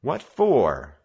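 put-on loud, high indignant boy; mild heated challenge; hot demand. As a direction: fire back in protest. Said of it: "Joe demanded hotly".

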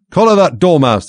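high, loud imperious shriek. A narrator's reading. Queen ordering the dormouse seized, loud high shriek. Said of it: "the Queen shrieked out".

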